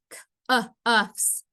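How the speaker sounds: cuffs split into c-, uh, uffs; segmented phonics demo of c-, uh, uffs; sounded out. Shown as a demonstration. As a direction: isolate c-, short uh, then uffs with gaps. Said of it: "have the same vowel sound".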